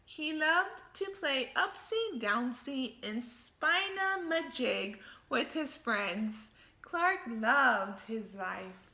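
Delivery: picture-book storytelling voice; story narration. A narrator's reading. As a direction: read it to the kids with gusto. Reads the story aloud.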